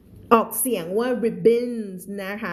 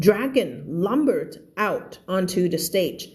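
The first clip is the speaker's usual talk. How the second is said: very slow, careful reading; slow read-aloud; 2 semitones lower, much slower than usual. Shown as a demonstration. Teacher reading the story line to her class, very slow. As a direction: read it word by word for learners. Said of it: "teacher reads sentence slowly".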